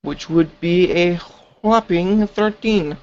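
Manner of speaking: slow, drawn-out, high announcement; mock grandiose. Real, not acted. Player announces a modest roll.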